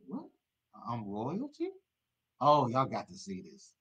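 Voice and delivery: ordinary delivery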